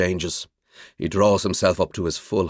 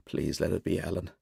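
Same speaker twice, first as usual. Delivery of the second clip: much softer, hushed plea; whispered prayer; 2 semitones lower, 9 dB quieter than usual. A narrator's reading. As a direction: pray it under your breath. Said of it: "I pray".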